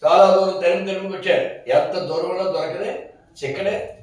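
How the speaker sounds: usual speaking voice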